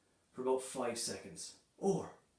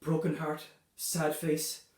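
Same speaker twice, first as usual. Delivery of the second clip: usual speaking voice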